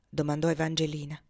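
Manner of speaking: ordinary delivery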